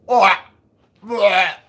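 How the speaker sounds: high, loud gagging "bleh" as if spitting out powder; disgusted spitting. Retold or imitated. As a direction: play the husband retching on a fake donut. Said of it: "He's like".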